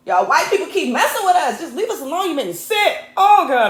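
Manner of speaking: loud, high, fed-up rant; exasperated outburst. Real, not acted.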